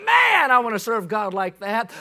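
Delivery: loud, slow preacher's cry; slow shouted wish; fervent shout. Retold or imitated. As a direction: cry it out with longing. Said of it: "I read that and thought".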